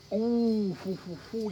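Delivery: high mock owl hoot; playful hooting. Real, not acted. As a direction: hoot it teasingly. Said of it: "you think so?".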